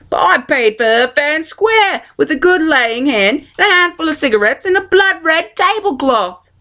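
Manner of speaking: put-on a plain country girl protesting; young girl Midge. A narrator's reading. Midge voiced pleading.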